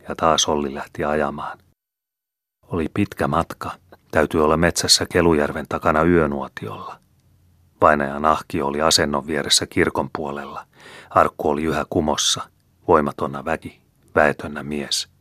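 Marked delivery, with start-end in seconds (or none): none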